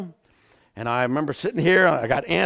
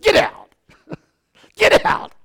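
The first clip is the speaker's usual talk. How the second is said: higher, louder, laughing disbelief; disbelieving get out; 7 dB louder than usual. Retold or imitated. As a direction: scoff it in shock. Disbelieving friend's reaction.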